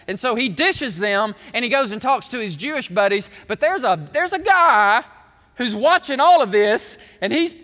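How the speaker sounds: loud, driving pulpit storytelling; full-force preaching. Real, not acted. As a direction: preach the scandal out loud, building to a high peak.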